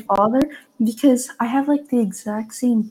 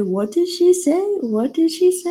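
imitating mom; 7 semitones higher than usual. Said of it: "My mom literally said".

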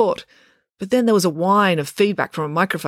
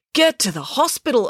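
higher-pitched, pressing instruction; urging; 8 semitones higher than usual. A narrator's reading. Finn sends the guard off to hospital, urging. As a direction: press them to go now. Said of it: "urged Finn".

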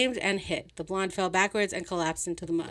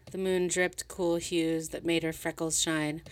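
ordinary delivery, nothing marked

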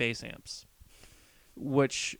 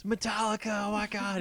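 imitated fan scream; 9 semitones higher than usual. Retelling a film's metal fan at the concert, mock scream. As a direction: imitate an overexcited fan shrieking, held back in volume. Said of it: "And he screams".

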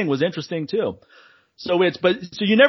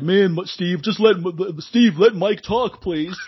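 higher, exasperated mock commenter; exasperated plea; 5 semitones higher, slower than usual. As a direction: plead in mock frustration, drawing out the request. Reads out an exasperated viewer's comment.

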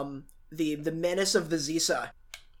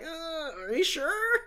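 timid unsure voice; 10 semitones higher, 4 dB quieter, slower than usual. Retold or imitated. Hesitant character quote.

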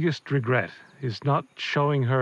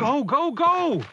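high, loud panicked yell to run; frantic shouting; 13 semitones higher, 4 dB louder, slower than usual. Acted. Father yelling at the kids to flee, frantic shout. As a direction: shout them out the door.

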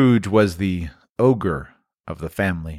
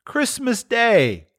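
higher, very slow gentle reminder; mild reminder; 14 semitones higher, much slower than usual. A narrator's reading. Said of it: "was Bob's mild answer".

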